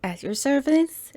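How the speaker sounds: high, loud upbeat welcome; a cheery hostess opening her show; bright channel intro. Acted. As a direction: greet the viewers with big energy.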